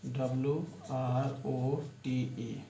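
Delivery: letters read slowly one by one; dictating letters slowly; spelled out slowly. Shown as a demonstration. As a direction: spell the word slowly for the class. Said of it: "जैसे रोट".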